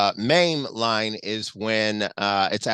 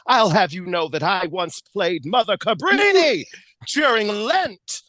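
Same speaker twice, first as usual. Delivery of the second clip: quoting Vera; 8 semitones higher, 3 dB louder than usual. Retold or imitated. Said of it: "when she also says".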